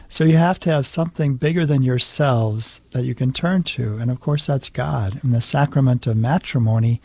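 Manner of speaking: ordinary delivery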